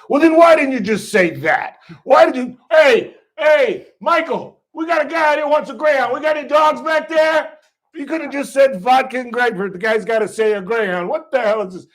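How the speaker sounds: high, loud hollering rant; irate Philly bartender. Retold or imitated. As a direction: yell to the back room like a fed-up barman. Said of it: "totally did it in the Philly accent".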